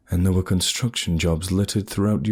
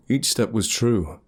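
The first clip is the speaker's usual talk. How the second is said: ordinary delivery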